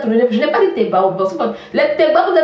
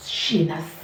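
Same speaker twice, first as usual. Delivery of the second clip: muttered insult; 9 semitones lower, 10 dB quieter than usual. Real, not acted.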